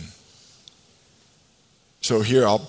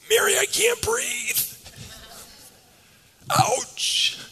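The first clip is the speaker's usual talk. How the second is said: comic squeezed voice; slower than usual. Pretend. Jesus squeezed too tightly, comic.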